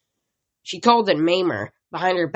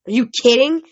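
higher, louder shocked protest; 7 semitones higher, 7 dB louder than usual. A narrator's reading. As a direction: push back in disbelief. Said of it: "Katie Gardner protested".

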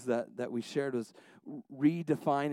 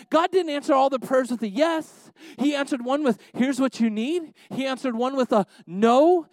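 high, loud, driving sermon voice; impassioned preaching; 9 semitones higher, 7 dB louder than usual. Acted. Preacher telling the church how God answered their prayers, impassioned. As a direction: proclaim it to the room with full force.

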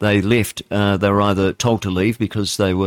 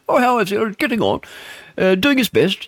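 much higher, mock-genteel royal chatter; posh royal voice; 12 semitones higher than usual. Host mocking royals to listeners, high posh imitation. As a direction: lampoon the royals' prim small talk, pitched way up. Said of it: "that's how they talk".

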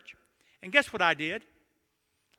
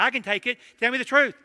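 loud, higher, punchy insistence; insistent demand; 6 semitones higher, 8 dB louder than usual. Retold or imitated. Husband telling his wife he can take it, loud mock bravado. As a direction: re-enact a husband demanding honesty.

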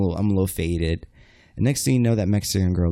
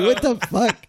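high-pitched mock-outraged reaction; retold shock; 13 semitones higher than usual. Guy being choked outside the bar, incredulous. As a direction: replay disbelief, pitch leaping up.

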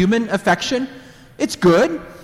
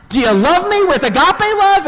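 insistent question; 14 semitones higher, 6 dB louder than usual. Acted. Jesus asking second time.

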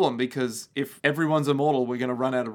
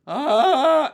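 startled yelp; 11 semitones higher, 9 dB louder than usual. Retold or imitated. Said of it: "shows up and goes".

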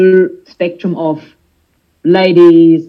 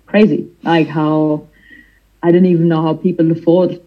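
usual speaking voice

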